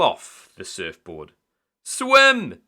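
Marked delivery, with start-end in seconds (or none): 1.8-2.6 high, loud shout from the shore; a loud, high urgent shout. A narrator's reading. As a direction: yell at him to swim. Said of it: "shouted Sam".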